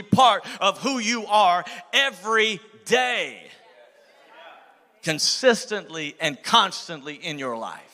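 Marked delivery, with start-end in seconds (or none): 0.0-3.5 slow drawn-out stress on part; slow, weighty preaching emphasis; stretched emphasis. Real, not acted. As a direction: lean on every word slowly. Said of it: "your worship has to be".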